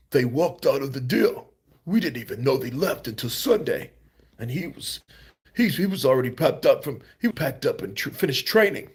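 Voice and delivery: imitating Frank Warren's Cockney accent. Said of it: "your Cockney accent needs some work".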